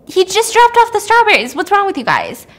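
high, loud exasperated insistence; acted frantic disbelief; incredulous protest. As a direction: insist as if nobody believes you. Hannah protests to puzzled parents.

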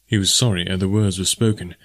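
ordinary delivery, nothing marked

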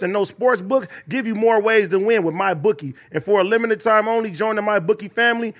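steady, even-pitched podcast ad read; host reading promo copy; sponsor ad read. Acted. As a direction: rattle through the sponsor copy smoothly. Reads a sponsor ad.

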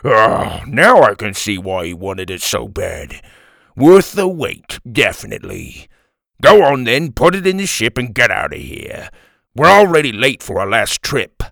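loud, chuckling crewman. A narrator's reading. Bald man delighted with the captain's treasure, loud chuckling. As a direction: start on a laugh, a gloating bald goon. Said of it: "The bald man chuckled".

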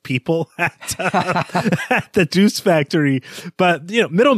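chuckling through the words; laughing. Real, not acted. Teasing his co-host about hating talkative people, laughing.

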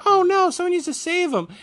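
hammed-up damsel voice; mock panicked princesses. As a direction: parody the characters' alarm. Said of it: "And they're like".

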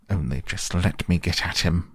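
plain reading voice

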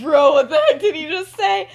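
high, loud, laughing astonishment; shocked disbelief. Acted. Sister hearing the secret blurted out, shocked. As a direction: blurt it out, scandalized and amused.

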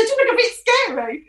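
high, giggly tease through laughter; laughing. Retold or imitated. Teasing the biker that he looked scary: laughing high.

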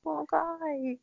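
put-on high cooing baby talk to cat; cute baby talk. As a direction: coo it high like to a pet.

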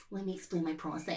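softly put-on pretentious artist voice; a hushed, self-mocking pretentious aside; mock wanker. Artist insisting on explaining the work, mock wanker. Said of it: "like a wanker".

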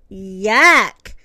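loud, low, drawn-out yuck; disgusted. A narrator's reading. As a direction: spit it out in revulsion. Puppy bites plastic cake.

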